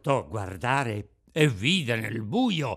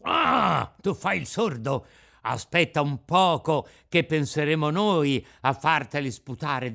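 menacing anger; 5 dB louder than usual. Acted. Bandit threatens the silent puppet.

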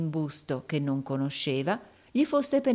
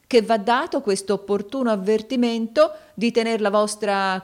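loud, raised, insistent character voice; goading moneylender; 5 semitones higher, 5 dB louder than usual. A narrator's reading. Rodolfo needling the old suitor about his rival, forceful taunting. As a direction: press the point hard, pitched up.

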